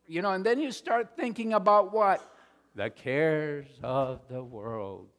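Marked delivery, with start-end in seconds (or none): 2.8-5.1 the cares of the world slowed right down; solemn emphasis. Real, not acted. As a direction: weigh each word, a preacher's warning. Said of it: "sermon emphasis".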